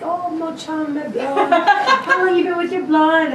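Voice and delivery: high Québécois accent imitation; Quebec French accent. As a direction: play a Quebec local. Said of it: "I feel like I live in Quebec".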